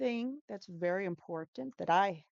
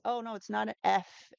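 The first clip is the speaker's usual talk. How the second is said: ordinary talk, nothing marked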